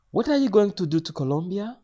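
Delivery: high, loud probing question; high-pitched imitation of a Spanish clerk; Spanish lady official. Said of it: "another lady asks in a Spanish accent".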